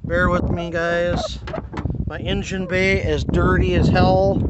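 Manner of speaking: ordinary talk, nothing marked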